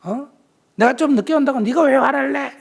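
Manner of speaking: high, loud mock marital retort; acted angry retort. Spouse snapping back at partner over being late, angry.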